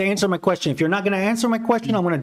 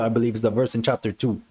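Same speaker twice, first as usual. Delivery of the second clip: ordinary talk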